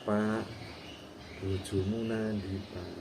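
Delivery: slow, low and soft; smooth, hushed polite delivery of a rude question; soft-mannered intonation. Acted. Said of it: "Intonasinya hal-, halus, caranya halus". (halus, lambat dan pelan)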